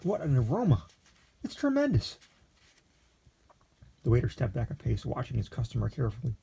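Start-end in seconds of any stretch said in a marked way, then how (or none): 0.0-2.2 rising high into rapturous delight; exclaiming. A narrator's reading. Lexington praising the aroma to the waiter, climbing excitedly. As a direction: swoon over the smell, voice climbing. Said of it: "he cri-, he exclaimed".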